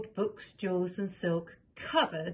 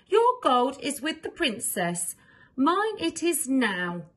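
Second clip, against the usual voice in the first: taunting note; 6 semitones higher, 6 dB louder, slower than usual. Acted. Thief's note read aloud.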